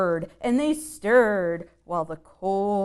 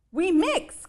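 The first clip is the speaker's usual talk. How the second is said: high, louder little voices; little mummies; 10 semitones higher, 4 dB louder than usual. Acted. Nine wee mummies answer their mommy, high. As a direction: chirp it back like wee mummies. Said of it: "called the nine".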